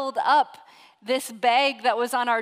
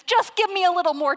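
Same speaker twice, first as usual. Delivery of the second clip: higher, louder desperate begging; pleading servant; 6 semitones higher, 7 dB louder, faster than usual. Retold or imitated. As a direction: plead for a little more time, voice pitched up. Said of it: "he pleads and he says".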